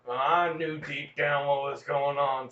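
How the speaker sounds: normal talking voice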